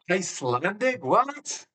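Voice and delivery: high, incredulous squeak of surprise; can't believe it's Icelandic; shocked disbelief. Real, not acted. Gamer finds out the clip was Icelandic, stunned surprise. Shocked at wrong language guess.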